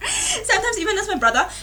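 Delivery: laughing as she says it; a giggly jab at her brother. Real, not acted. Teasing her brother to viewers, laughing.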